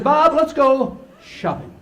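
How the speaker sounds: put-on high, slow, sweet little girl asking. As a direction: coax grandpa like a five-year-old. Granddaughter voiced in story.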